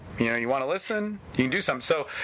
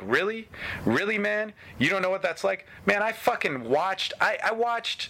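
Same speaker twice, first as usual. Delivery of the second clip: higher, mocking disbelief at Really; incredulous retort; 8 semitones higher than usual. Retelling his answer to a whining client, incredulous and high. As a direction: throw his complaint back at him, incredulous. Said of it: "I'm like".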